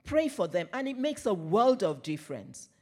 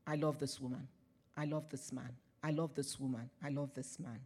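soft and lower, a tender vow said under the breath; quiet loving affirmation; 5 semitones lower, 10 dB quieter than usual. Shown as a demonstration. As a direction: say it softly like a quiet promise to yourself. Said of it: "just go in and say".

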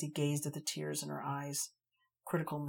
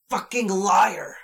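furious scream, high and loud; enraged scream at a liar; 5 semitones higher, 16 dB louder, slower than usual. A narrator's reading. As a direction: scream it in her face, slowly. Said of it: "she screams".

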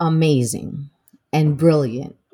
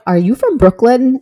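surprised question; 7 semitones higher, 8 dB louder than usual. Retold or imitated. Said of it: "they're like".

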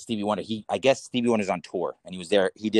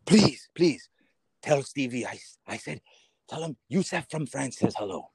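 put-on higher, pleading, lightly French-accented; a hint of Yusef begging in a French accent; 4 semitones higher than usual. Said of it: "This guy's name's Yusef".

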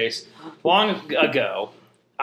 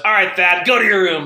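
loud, higher mock parental order; scolding mom; 5 semitones higher, 7 dB louder than usual. Mom punishing the wrong son straight away, scolding. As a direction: send him off like an exasperated mom.

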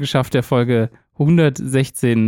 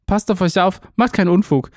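ordinary talk

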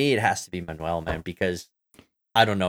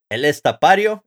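Spanish-style accent; 6 semitones higher, 7 dB louder than usual. Shown as a demonstration. Checking how to say the drummer's name, Spanish accent. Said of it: "We can say it like an American".

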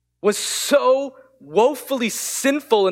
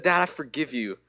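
put-on soft, lower, tender child's reply; quietly forgive her dad with love; gentle forgiveness; 5 semitones lower, 7 dB quieter than usual.

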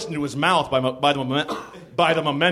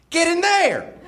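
very high, outraged mock yell; mock yell back; 3 dB louder than usual. A narrator's reading. Narrator plays Tammy Faye accusing Jim, very high mock yell. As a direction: finish the question in a high cry. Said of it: "yelled back Tammy Faye".

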